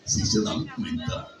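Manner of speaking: squeaky high cartoon-dog whisper; high breathy Goofy question. Shown as a demonstration. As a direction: ask in a falsetto Goofy squeak. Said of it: "la voz de Goofy".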